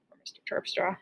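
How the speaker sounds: soft whispered joke slipped in; sneaky stage whisper; whispered aside. Real, not acted. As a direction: whisper it like a secret aside to the class. Joking aside about another teacher.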